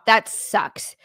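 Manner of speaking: usual speaking voice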